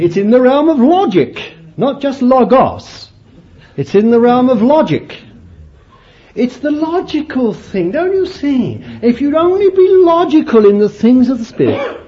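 loud, high, hammering preaching; fervent preaching. Acted. Preacher urging the congregation, fervent and loud. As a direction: drive it home from the pulpit.

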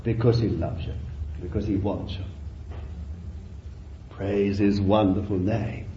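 low, soft preacher's aside; quiet, low-pitched words to the congregation; hushed devotion. Real, not acted. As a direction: drop right down into intimate reverence.

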